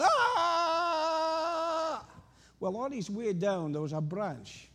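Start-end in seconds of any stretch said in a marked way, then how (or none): 0.0-2.1 high, loud falling scream; falling yell. Pretend. Man falling off a cliff, high yell. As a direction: let out a high yell as you drop. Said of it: "you can hear the yell".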